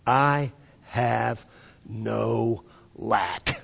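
slow and deliberate. Real, not acted. Preacher giving the church his translation, slow and deliberate. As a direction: pause between words and let each settle. Slow solemn statement of the verse.

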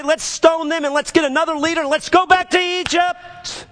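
high, strained mob cry; rally the grumblers, pitch climbing high; riled-up crowd. Retold or imitated. Grumbling Israelites wanting to stone their leaders, riled up.

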